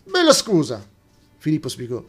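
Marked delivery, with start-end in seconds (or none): 0.0-0.9 higher and louder, sarcastic retort; sharp interruption. Acted. Laura cutting off Filippo's apology, sarcastic and loud. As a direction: cut him off with scorn. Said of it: "Lei lo interruppe dicendo".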